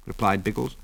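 ordinary delivery, nothing marked

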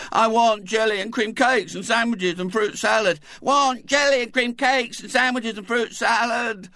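high, loud, shouted chant; wailing chorus of children. A narrator's reading. The guests wailing together for party food. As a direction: bawl it like a crowd of greedy kids. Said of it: "wailed the chorus".

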